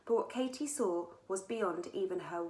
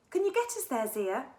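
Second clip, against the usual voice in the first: eager and high, louder; encouraging; 6 semitones higher, 6 dB louder than usual. A narrator's reading. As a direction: urge a friend on eagerly. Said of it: "encouraged Katie".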